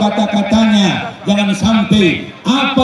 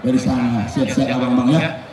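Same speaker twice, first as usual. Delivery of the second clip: a lightly voiced loving wife; sweet wife greeting; 9 semitones lower than usual. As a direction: greet him sweetly at the door. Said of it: "Sambut dia senyum".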